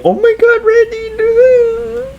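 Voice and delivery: very high squealed mock cry; shriek-wail like a horrified movie heroine; hammed-up, squeaky sobbing. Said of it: "Sydney's like".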